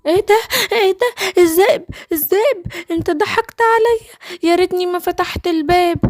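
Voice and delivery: high-pitched panic at being tricked; frightened, high little gazelle; alarmed young gazelle. Acted. Gazelle discovers the wolf tricked her.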